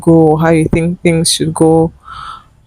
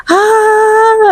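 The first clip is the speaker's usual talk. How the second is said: mock scream. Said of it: "I started screaming".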